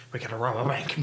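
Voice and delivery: put-on low, quiet Boston accent. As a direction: do Ben Affleck's Boston accent. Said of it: "in his Boston accent".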